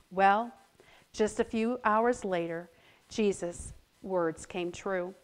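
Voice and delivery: usual speaking voice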